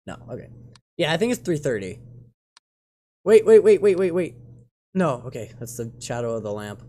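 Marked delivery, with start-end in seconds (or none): 3.2-4.4 rapid-fire repeated words, starting loud and high; urgent alarm. Real, not acted. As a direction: halt everything, urgently. Spots possible anomaly.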